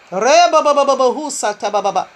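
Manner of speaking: speaking in tongues. Real, not acted.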